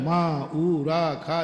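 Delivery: slow, each syllable set apart. Shown as a demonstration. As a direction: sound out "माँ ऊ रा खा" slowly like seed letters. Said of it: "आगम में लिखा है". ("माँ ऊ रा खा" धीरे, हर अक्षर अलग)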